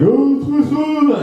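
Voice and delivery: high, slowed, hammed-up cry of relief; relieved dad. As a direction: play the dad overjoyed to find his son. Dad finds his son.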